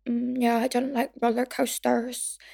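slow, soft mock reluctance; reluctant whiner impression. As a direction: mimic an unenthusiastic friend, dragging it out. Mimics reluctant friends at the fair.

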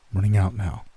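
usual speaking voice

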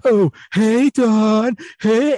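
high, loud sing-song call. Retold or imitated. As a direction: shout a teasing hello across the yard. Calling out to Don mockingly.

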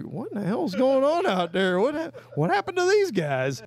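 high, rising mock disbelief; hammed-up dock guys shouting questions; baffled dockhands. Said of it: "all the guys standing on the docks are like".

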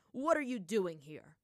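loud, startled demand; stunned exclamation. A narrator's reading. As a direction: demand it loudly, caught by surprise. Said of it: "Bato exclaimed, stunned".

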